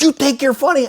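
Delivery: high, indignant mock outburst; ticked-off agent. Agent snapping back at the radio host, imitated high indignation. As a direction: imitate an incensed man challenging the joker. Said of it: "He's so ticked off".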